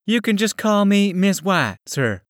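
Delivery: put-on high, polite young woman's voice; a lightly voiced polite secretary. A narrator's reading. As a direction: pitch it up and keep it courteous. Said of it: "she said in a polite manner".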